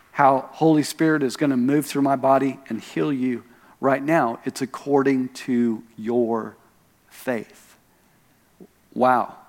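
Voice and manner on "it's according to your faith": slow, lower, softer, landing on "your faith"; stress on "your faith". Retold or imitated. Preacher driving home Jesus's statement, slow stress on "your faith". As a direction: set "your" and "faith" apart, "faith" dropping low and soft. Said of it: "He put the emphasis on their faith".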